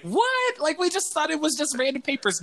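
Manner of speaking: very high, squeaky what then a pleading excuse; mock kids' disbelief. Kids defending themselves to the counselor, squeaky mock disbelief. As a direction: play the shocked kids high and whiny. Kids protest their innocence.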